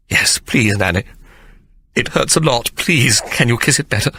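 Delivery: put-on high, pleading little-boy voice; urgent gasping plea. A narrator's reading. Sir Jasper pleading with Nanny, urgent gasps. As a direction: beg with fear and eagerness. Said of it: "Sir Jasper gasped urgently".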